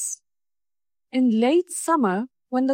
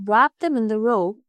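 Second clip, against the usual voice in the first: plain reading voice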